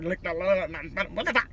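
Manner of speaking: mock her own mumbling; garbled fast talk. Vlogger mocking her fast talk, garbled Lick the Lord. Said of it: "I be talking too fucking fast and I be like".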